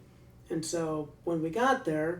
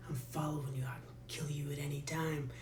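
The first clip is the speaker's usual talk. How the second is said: menacing whisper; 3 semitones lower, 12 dB quieter than usual. Retold or imitated. Guard threatens prisoner quietly.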